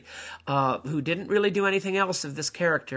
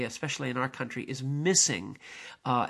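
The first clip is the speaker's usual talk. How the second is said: ordinary delivery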